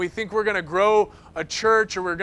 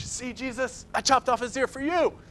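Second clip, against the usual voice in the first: a lightly voiced, higher, eager Peter showing off; overeager zealous Peter; 5 semitones higher, 4 dB quieter than usual. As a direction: play Peter proudly trying to impress Jesus. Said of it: "Mister Zealous".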